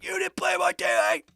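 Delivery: high-pitched, overexcited mimicry; excited friend. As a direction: imitate a buddy thrilled by the news. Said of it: "he was all excited about it".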